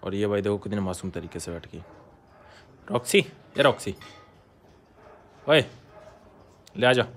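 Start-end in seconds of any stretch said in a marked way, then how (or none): 2.9-7.1 raised, higher-pitched call in short bursts; calling a dog. Real, not acted. Owner to his pitbull Roxy, calling out.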